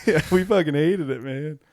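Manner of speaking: laughing through a mock line; sarcastic laughing. Mocking bunnies, laughing.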